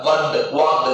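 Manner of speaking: വാക്ഡ് said with a clear extra "ed" syllable, wa-ked; വാക്ഡ് slow, ending in a full "ed"; common Malayali pronunciation. Shown as a demonstration. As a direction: spell out വാക്ഡ് the way students say it, slowly. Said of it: "വാക്ഡ് എന്നുള്ള ഉച്ചാരണം".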